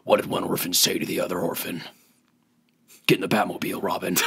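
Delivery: low, gruff whispered Batman growl. Retold or imitated. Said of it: "Do the voice".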